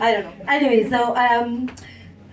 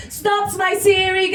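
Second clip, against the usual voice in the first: lightly voiced assistant, higher and slow; higher, measured imitation of a digital helper; voice assistant Siri; 7 semitones higher, slower than usual. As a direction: mimic a phone assistant reading results. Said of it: "And she'll come out".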